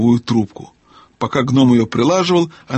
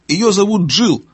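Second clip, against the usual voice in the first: raised, higher-pitched call; owl calling out; 8 semitones higher than usual. A narrator's reading. Owl repeating the girl's name to deaf gnome, calling out. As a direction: call it up high for deaf ears. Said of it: "громко крикнула сова".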